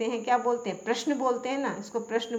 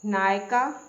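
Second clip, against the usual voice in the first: normal talking voice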